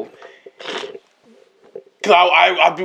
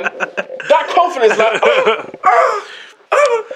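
laughing, rising "oh"s; 7 semitones higher, much slower than usual. Real, not acted. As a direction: giggle out the words. Laughing, high exclamations.

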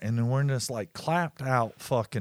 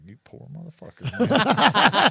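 pitying then shouting man; loud mock pity; 9 semitones higher, 7 dB louder than usual. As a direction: fake sympathy ending in a yell.

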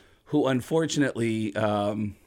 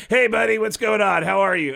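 Tom Arnold voice; 8 semitones higher, 6 dB louder than usual. Retold or imitated. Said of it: "And Tom Arnold goes".

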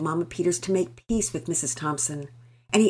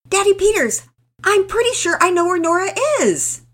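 high, loud eager call; shouting child; 11 dB louder than usual. Acted. Girl calling to Daddy Peters, high eager shout. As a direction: shout it out, sure of the answer. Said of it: "Penelope shouted".

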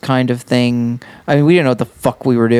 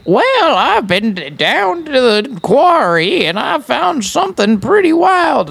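put-on high, drawn-out Old West twang; exaggerated Old West voice; 10 semitones higher than usual. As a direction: go way up high for an extreme cowboy accent. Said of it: "extreme voices of the West".

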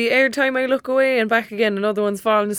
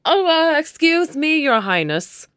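mock deference; 6 semitones higher, 5 dB louder, slower than usual. Mocking friend's bossiness.

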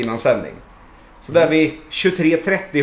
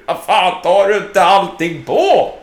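loud, slow, angry demand; 4 semitones higher, 5 dB louder, slower than usual. Retold or imitated. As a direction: rant at him, losing it. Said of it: "lackar ju den här snubben ur".

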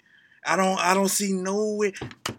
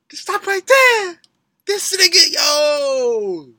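much higher, loud hyped yell; excited shout; 10 semitones higher, 9 dB louder, slower than usual. Real, not acted. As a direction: shout in amazement, stopping the song. Hyped reaction, cutting the track.

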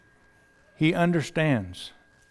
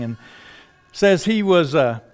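normal talking voice

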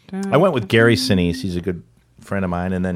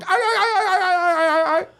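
high, rapid repeated yelping; imitated ululation. Comedian imitating local people, high ululation. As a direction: trill it out in a mock falsetto chant. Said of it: "people in towels going".

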